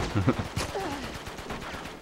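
high, soft, fading giggle. Real, not acted. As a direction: giggle at your own joke. Laughs after pirate joke.

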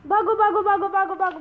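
excited shouting at the dog; excited chase shout. Real, not acted. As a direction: urge the dog on in play.

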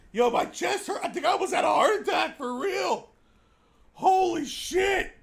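high and loud, half laughing; real adrenaline rush; shaken shouting. Real, not acted. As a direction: still buzzing from the fright. Recovering from a scare.

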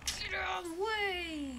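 high, loud, slow yell; shout. Real, not acted. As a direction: yell at him to move. Enemy approaching.

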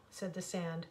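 usual speaking voice

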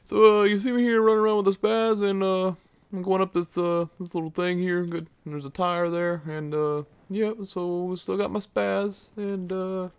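high, fast-talking commentator parody; mock gameplay commentator. Mocking other YouTubers, he apes a high commentator voice. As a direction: ham up a generic YouTube commentator narrating his match. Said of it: "they just talk about the gameplay".

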